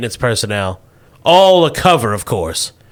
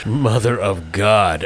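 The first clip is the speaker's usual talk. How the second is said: slow, lowered groan of disbelief; exasperated despair; 3 semitones lower, slower than usual. Acted. Boss gives up on agent's potato talk, exasperated. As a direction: let it out wearily, drawn out.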